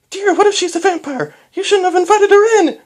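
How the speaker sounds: very high, loud hysterical mother voice; panicky mom. A narrator's reading. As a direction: squeal it as an alarmed wife. Panicked mother warns about a vampire.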